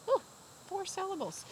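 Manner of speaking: high, bright announcement to the class; excited reveal. Real, not acted. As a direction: announce the answer with delight. Teacher excited answer reveal.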